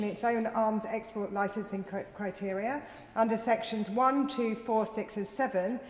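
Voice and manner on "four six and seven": ordinary talk, nothing marked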